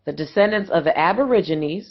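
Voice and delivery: ordinary talk, nothing marked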